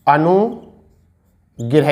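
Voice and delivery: each syllable slowly drawn out; slow dictation. Shown as a demonstration. Teacher dictating numbers to students, slow and drawn out. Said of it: "ये तो ऐसे लिखा जाता है".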